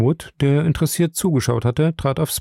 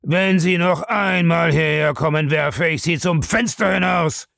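roared threat, pitched high; angry roar; 7 semitones higher than usual. A narrator's reading. Bellamy threatens to throw Krieger out the window, roaring. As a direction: bellow it as one long threat. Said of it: "brüllte Bellamy".

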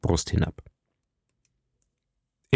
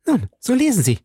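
high, elated call; joyful exclamation, pitched well up; overjoyed, proud cry; 13 semitones higher than usual. A narrator's reading. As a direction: cry it out with joy and pride. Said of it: "rief im Übermaß der Freude und des Stolzes".

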